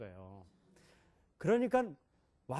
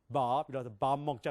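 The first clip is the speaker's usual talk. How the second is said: put-on American accent; 3 semitones lower than usual. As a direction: lower American-accented Korean, long "Baab" for 밥. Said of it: "미국 사람도 어떻게 하면". (낮게 늘이는 미국식 억양)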